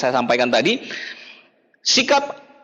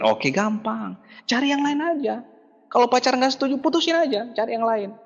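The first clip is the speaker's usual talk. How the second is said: breezy dismissal; 6 semitones higher than usual. Retold or imitated. Upline brushing off problems.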